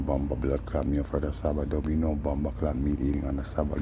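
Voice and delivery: usual speaking voice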